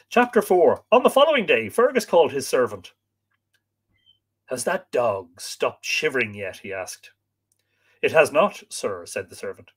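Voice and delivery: lively, dramatic read-aloud; expressive storyteller with acted dialogue; story narration. A narrator's reading. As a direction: read the tale with wide swings of pitch.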